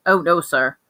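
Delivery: plain reading voice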